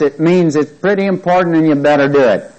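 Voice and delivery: ordinary delivery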